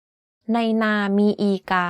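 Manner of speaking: "ในนามีอีกา" in one constant pitch; middle tone on "ในนามีอีกา". Shown as a demonstration. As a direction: keep every syllable of "ในนามีอีกา" level, at normal pitch. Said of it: "All of these are in constant pitch".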